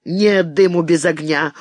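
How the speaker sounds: usual speaking voice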